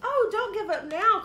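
high, loud pleading cry. A narrator's reading. Rabbit begging Mouse not to give up, crying out. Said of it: "cried Rabbit".

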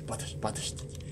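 breathless whisper, barely voiced. Real, not acted. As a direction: whisper it under your breath. Panicking while lost in level.

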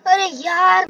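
usual speaking voice

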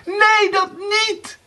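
very high, panicked pleading; frightened screaming. A narrator's reading. Erika begs Blauwbaard for her life, screaming in fear. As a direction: shriek your desperate promise. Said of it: "schreeuwde angstig".

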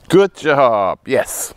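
loud bright praise; excited praise. Real, not acted. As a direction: cheer the dog on. Praising dog, louder higher.

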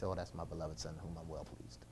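soft, hushed proud father voice; the Father proud of his son, softly pleased; a hint of proud Father. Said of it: "the Father just smiles".

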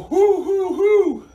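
high, loud hooting; owl hoots. Shown as a demonstration. Coach leading kids as owls, high loud hoots. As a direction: hoot three times like an owl.

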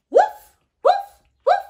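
loud, high-pitched woofs; three short, high dog woofs; dog barking. Acted. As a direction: bark like a dog for children. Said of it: "says the dog".

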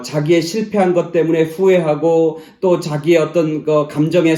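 loud, high, driving sermon voice; fervent preaching. Acted. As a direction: preach it out to the whole church. Loud preaching at full force.

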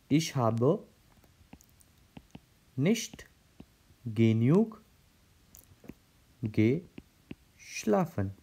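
each word spaced out with long pauses, very slow; slow word-by-word dictation. Shown as a demonstration. German teacher slowly dictating the example sentence to the class. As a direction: dictate it slowly so students can write it down. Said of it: "so how I'm going to use?".

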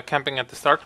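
ordinary talk, nothing marked

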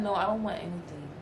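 quiet refusal. Retold or imitated. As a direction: say it low and done. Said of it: "I said".

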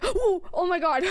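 gasp, then a high burst of laughter; startled gasp. Real, not acted. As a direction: caught off guard, laugh it out. Gasp at spotting anomaly.

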